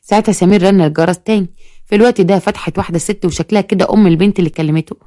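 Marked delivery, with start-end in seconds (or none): none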